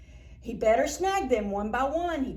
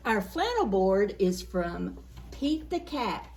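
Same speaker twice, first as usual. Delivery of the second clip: ordinary delivery, nothing marked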